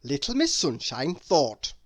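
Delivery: usual speaking voice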